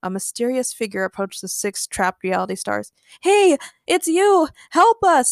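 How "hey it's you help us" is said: loud, high cry for help; calling out. A narrator's reading. Sierra calls to a mysterious figure for help, calling out. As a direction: shout it slowly to someone across the way. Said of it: "Sierra called out to the mysterious figure".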